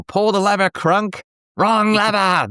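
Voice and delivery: high-pitched quoted movie outburst; hammed-up high cry of command; mock villain yell. Acts out a shouted movie quote.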